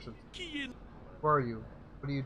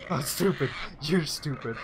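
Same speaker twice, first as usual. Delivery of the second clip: gamorrean retort; 5 dB louder, slower than usual. Acted. Gamorreans trading insults.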